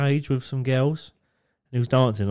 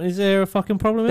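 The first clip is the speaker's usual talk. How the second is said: mock tough challenge; 7 semitones higher, 4 dB louder than usual. Retold confrontation.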